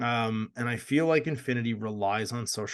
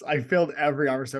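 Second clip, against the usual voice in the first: ordinary delivery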